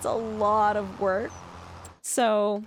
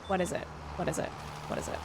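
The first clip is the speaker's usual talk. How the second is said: fading away; 4 semitones lower, 8 dB quieter, much faster than usual. Acted. Repeats question, fading away.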